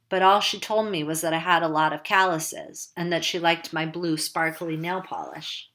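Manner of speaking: ordinary delivery, nothing marked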